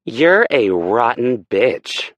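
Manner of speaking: slow, loud, spitting rage; furious insult. Acted. Aspen lashes out at Hurricane, slow and furious. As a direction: bite out every word with hate. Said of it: "her eyes flashing with rage".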